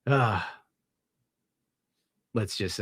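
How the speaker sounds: ordinary talk, nothing marked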